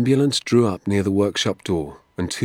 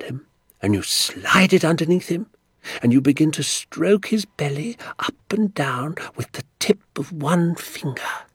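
doctor relishing his secret; 7 semitones higher than usual. Acted. Doctor teaching Danny to tickle trout, relishing every step. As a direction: build the suspense step by step.

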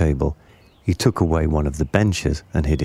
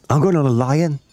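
put-on high-pitched eager child; excited child's cry; 8 semitones higher, 3 dB louder than usual. A narrator's reading. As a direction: call it out high like a thrilled child. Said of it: "cried a third one".

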